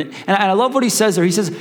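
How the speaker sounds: ordinary talk, nothing marked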